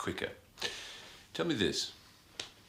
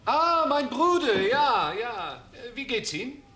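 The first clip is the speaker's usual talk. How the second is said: nervous bluffing; 7 semitones higher, 4 dB louder, slower than usual. Acted.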